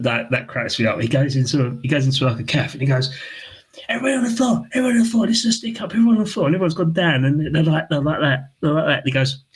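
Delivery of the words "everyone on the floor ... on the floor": high mock stick-up call; hammed-up hold-up order; mock robber. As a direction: bark it like a fake robber. Fake stick-up shout in cafe.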